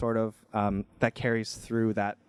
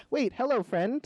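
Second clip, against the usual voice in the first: high-pitched hail, only mildly shouted; calling out; 13 semitones higher than usual. A narrator's reading. Jomny hails a passing beaver, high mock shout. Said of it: "Jomny sees a beaver and shouts".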